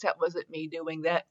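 ordinary talk

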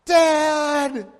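high, loud call for dad; daughter's scream. Retold or imitated. A father recalls his hurt daughter calling out, loud high call.

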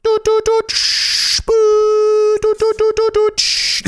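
high beeping tones imitating a cockpit. Mimicking fighter cockpit tones for viewers, high beeps. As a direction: beep out the cockpit warning sounds with your mouth. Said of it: "You get tones".

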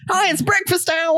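a cartoon breakfast mascot announcing itself. Cartoon egg mascot greeting.

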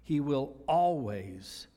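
"always" drawn out slow and weighted; stress on always. Real, not acted. Pastor stressing "always" to the congregation, slow and weighty. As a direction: lean hard and slow into "always". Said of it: "and I emphasize the word always".

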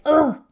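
loud, high groan of frustration; frustrated ugh. Acted. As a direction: groan "ugh" in mock exasperation. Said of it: "you can feel kinda like".

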